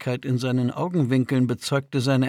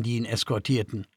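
ordinary delivery

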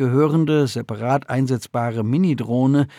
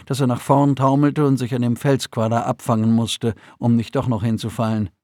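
ordinary delivery

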